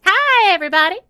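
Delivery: high, loud hand puppet greeting; Proxy. Pretend. Puppet co-host greets the podcast audience, high and loud. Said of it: "I've actually got Proxy here".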